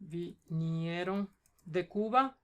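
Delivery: "Vinieron de Cuba" drawn out slowly, with pauses between the parts; slow dictation. Shown as a demonstration. Teacher repeating the sentence for a student, "Vinieron de Cuba" in slow dictation.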